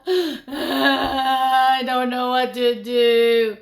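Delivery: loud wailing child, hammed up; hammed-up sobbing wail; mock crying. Shown as a demonstration. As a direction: wail it like a helpless crying kid. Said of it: "if you just cry like that".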